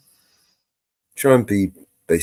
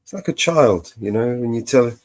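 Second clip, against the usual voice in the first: ordinary talk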